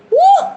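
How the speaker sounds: short, very high, loud woo; excited whoop. Real, not acted. Carried away over her man, excited whoop.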